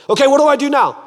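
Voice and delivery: high, loud, put-upon voice; exasperated question. Acted. Man asks God for the next step, exasperated question. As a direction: ask it high and loud, worn out with orders.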